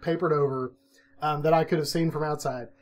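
usual speaking voice

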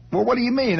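put-on high, indignant question as a boy; indignant kid. Boy defending himself to his cousin, high and indignant. Retold childhood exchange, cousin.

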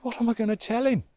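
fast, high, heartbroken fret; a badger at a loss, quick and anguished; devastated. A narrator's reading. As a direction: blurt it out in despair. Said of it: "He was devastated, broken-hearted".

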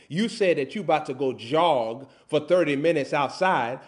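ordinary talk, nothing marked

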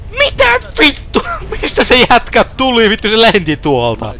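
very high-pitched squeal of surprise; real startled disbelief. Real, not acted. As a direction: blurt it out high in utter disbelief. Startled by man appearing suddenly. (korkea, hämmästynyt kiljahdus)

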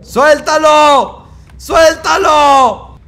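loud, high, slow shout; desperate shout. Real, not acted. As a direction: yell it out, drawn long. Yelling at the baby.

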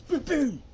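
sharp double punch sound; loud booms. Shown as a demonstration. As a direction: land two quick hits with your voice.

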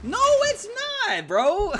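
high, loud protest breaking into laughter; laughing protest. Real, not acted. Streamer denying he sought the picture, laughing high and loud. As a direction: yelp a denial through giggles.

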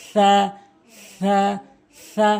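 tongue tip on the front teeth edges for সা; correct সা. Shown as a demonstration. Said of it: "সঠিকভাবে উচ্চারণ হবে".

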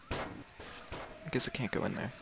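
slow whispered aside. Real, not acted. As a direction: say it under your breath. Muttering aside.

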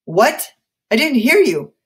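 high, loud, insistent demand; prodding. A narrator's reading. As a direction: push them to speak up. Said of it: "Samuel prodded".

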